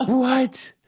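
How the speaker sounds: overwhelmed with delight, can't believe there are trees; ecstatic squeal. Retold or imitated. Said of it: "I was like".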